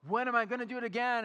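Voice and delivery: high, eager, rushing the end; gush, then race ahead to the next one; excited about the next thing; excited already planning. Retold or imitated.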